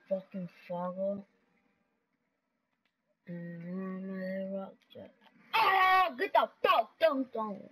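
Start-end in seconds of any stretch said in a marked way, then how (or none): 5.5-7.6 loud, higher yelp and shout down; real gamer yell; sudden yell. Real, not acted. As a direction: yell at the screen. Yelling at game opponent.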